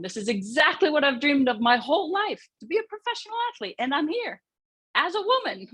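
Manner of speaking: rising high, quick and giddy; thrilled younger self. Retold or imitated. Young athlete marvelling at living her dream, giddy. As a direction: let wonder lift the voice high, ending in a laugh.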